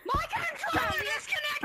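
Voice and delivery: high panicked outburst; alarmed shout. Real, not acted. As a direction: blurt it out in sudden alarm. Controller drops mid game.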